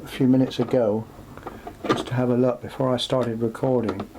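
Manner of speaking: usual speaking voice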